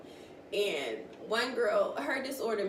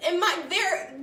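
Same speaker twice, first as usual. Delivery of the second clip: louder, higher amused telling; excited; 9 semitones higher, 9 dB louder than usual. Real, not acted. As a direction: tell it with rising glee.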